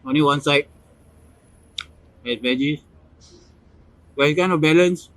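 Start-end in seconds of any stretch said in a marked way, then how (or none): 4.2-5.1 talking through a mouthful; chewing while talking, mouth full. Real, not acted. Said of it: "Sorry if I talk with my mouth full".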